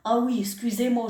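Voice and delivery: normal talking voice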